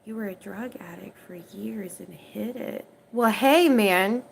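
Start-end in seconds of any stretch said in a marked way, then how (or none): 0.0-2.8 reading quietly to self. Real, not acted. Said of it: "reading a viewer's question".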